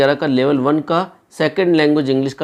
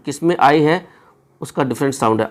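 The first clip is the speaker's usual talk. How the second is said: ordinary delivery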